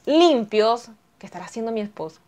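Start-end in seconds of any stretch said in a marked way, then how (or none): 1.2-2.2 quiet aside. Real, not acted. Aside wondering about noisy husband.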